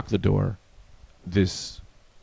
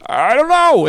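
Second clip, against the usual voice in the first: high, loud mock exasperation; acted helpless yell; exasperated outburst; 6 dB louder, slower than usual. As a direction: burst out as if cornered. Neighbor cornered in acted argument.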